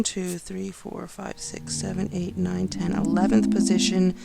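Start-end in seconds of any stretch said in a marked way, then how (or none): 0.0-2.9 counting under breath. Real, not acted.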